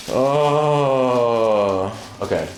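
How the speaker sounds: ordinary talk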